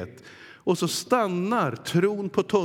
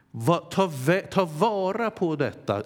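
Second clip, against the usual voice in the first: ordinary talk, nothing marked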